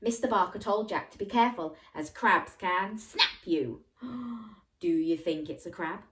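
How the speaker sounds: usual speaking voice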